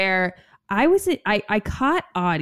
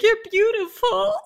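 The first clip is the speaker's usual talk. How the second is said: high, drawn-out pretend weeping; mock sobbing; 13 semitones higher than usual. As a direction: squeak it out as if overcome with tears, hammed up. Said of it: "sit here and sob and be like".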